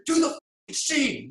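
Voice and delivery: high, loud yell of an impatient director; retold yell. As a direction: yell the order, imitating an angry director, drawn out. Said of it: "yells at me".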